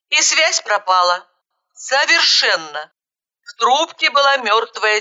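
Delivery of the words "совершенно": ordinary delivery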